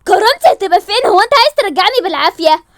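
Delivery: high, loud defiant yell; angry shout. Acted. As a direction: throw it back at him. Layali refuses, shouting. (صراخ غاضب رافض)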